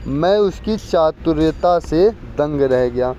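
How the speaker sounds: teacher slowly reading the exam sentence to students, deliberate. Shown as a demonstration. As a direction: read the sentence out slowly, word by word. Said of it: "दिए गए वाक्य में किस भाग में त्रुटि है?".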